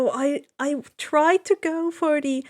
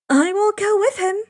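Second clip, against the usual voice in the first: put-on higher girl's voice, offering; Annabeth offering; 8 semitones higher, 4 dB louder than usual. A narrator's reading. Annabeth offers to join Odysseus, higher voice. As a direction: step up as the young girl. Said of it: "Annabeth offers".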